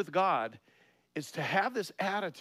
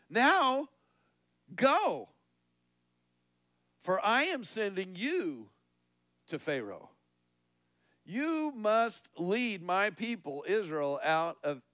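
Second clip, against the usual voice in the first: commanding God; 4 semitones higher, 4 dB louder, slower than usual. Acted. God commanding Moses, sermon reading.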